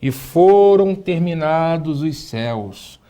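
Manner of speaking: drawn-out, deliberate translation; slow solemn reading. Shown as a demonstration. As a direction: stretch the words as if reciting a verse. Teacher reads out the translation slowly. (leitura lenta, palavras alongadas)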